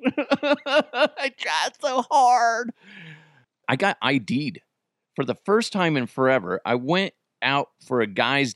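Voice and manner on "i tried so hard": drawn-out, high-pitched mock wail; fake-sobbing through a laugh; mock crying. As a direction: whine it with hammed-up hurt, stretching it out. Mock hurt reply to kids.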